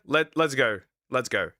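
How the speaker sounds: ordinary talk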